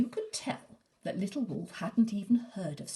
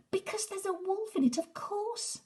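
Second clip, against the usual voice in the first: put-on much higher, earnest little boy; child's voice. Herb telling his mother why, in a high child's voice. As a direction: chirp it like a small boy sure he's right.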